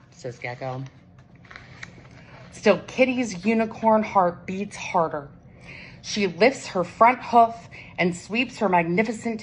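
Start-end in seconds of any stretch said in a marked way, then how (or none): none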